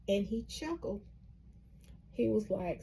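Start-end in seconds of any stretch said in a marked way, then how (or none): none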